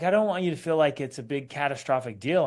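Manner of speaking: ordinary delivery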